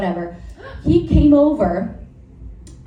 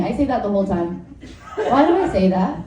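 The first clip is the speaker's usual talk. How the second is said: normal talking voice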